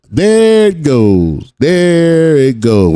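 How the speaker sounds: slow, drawn-out "there it goes", said knowingly; mock-weary sigh of recognition; knowing drawl. Real, not acted. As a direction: drag it out like you saw it coming. Reacting to the cop arriving.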